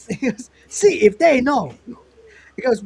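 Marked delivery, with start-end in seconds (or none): 0.7-1.7 put-on high, loud caricatured Taiwanese accent; Taiwan Tony.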